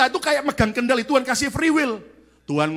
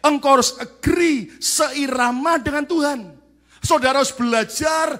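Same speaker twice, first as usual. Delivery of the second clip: louder and higher, slow and emphatic; slowed, raised exhortation; full-force preaching; 5 semitones higher, 5 dB louder than usual. Acted. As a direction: drive the point home from the pulpit.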